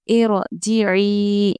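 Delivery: very slow chanted इरजिई, heavy र; Quranic recitation. Shown as a demonstration. Teacher reciting the drill word, slow and chanted. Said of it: "रॉ को पुर पढ़ेंगे".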